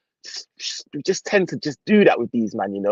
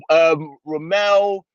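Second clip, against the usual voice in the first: calling out; 5 dB louder, much slower than usual. Real, not acted. Calling out to his co-host.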